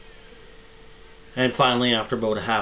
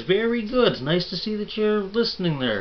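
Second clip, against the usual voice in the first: far higher and louder, gushing preacher praise; delighted preacher; 11 semitones higher, 6 dB louder than usual. Acted. Preacher praises Ernie to the congregation, high and gushing. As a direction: beam it out like a thrilled pastor.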